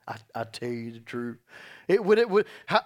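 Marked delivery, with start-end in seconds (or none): 0.1-1.4 lower, softer, wry aside; wry mock quote. Shown as a demonstration. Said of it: "Isn't that funny that Jesus had to say that?".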